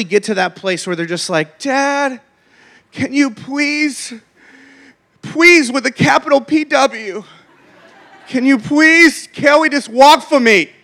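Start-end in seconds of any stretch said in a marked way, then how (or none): 1.6-10.7 put-on higher, slow, whiny child's plea; begging kid. Exhausted son begging dad to carry it, whiny plea. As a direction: drag out the pleading like a worn-out kid.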